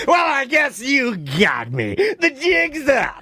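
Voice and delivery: slow, drawn-out theatrical surrender, climbing high at the end; relishing mock confession. Caught not knowing someone's name, gleefully theatrical confession. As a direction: savour being caught out, hamming it up. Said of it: "I relish it at that point".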